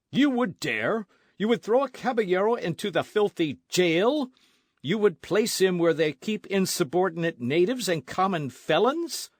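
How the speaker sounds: high, loud indignant tirade; outraged. A narrator's reading. Don Carlos raging at the sergeant over the filthy jail, loud and high. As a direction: hurl it at the sergeant in fury.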